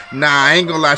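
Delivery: ordinary talk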